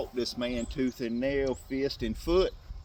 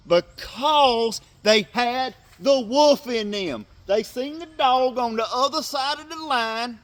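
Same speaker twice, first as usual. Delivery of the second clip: loud, high, slowed preaching shout; comparing the Eagles' second half to faith; preaching at full force; 10 semitones higher, 10 dB louder than usual. Acted. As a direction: thunder it like a revival preacher. Sports sermon at full force.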